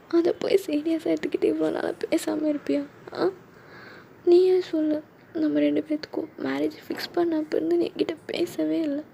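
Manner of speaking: high-pitched, soft weepy complaint; crying. Acted. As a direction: pour out hurt to the fiancé through tears. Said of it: "சரி, அழாத".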